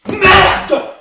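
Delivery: explosive high shout; one loud burst of anger; furious curse. Acted. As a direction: yell it at full force. Director explodes after argument.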